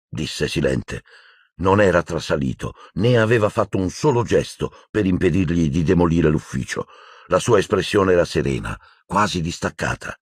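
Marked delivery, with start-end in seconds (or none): none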